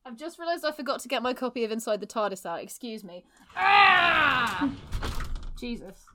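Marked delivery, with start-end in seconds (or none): 3.6-4.7 sudden loud high yelp of alarm; startled shout. Real, not acted. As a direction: cry out as the door gives way. Pushed wardrobe door off track.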